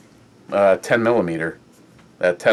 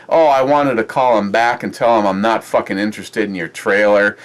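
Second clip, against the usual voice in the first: normal talking voice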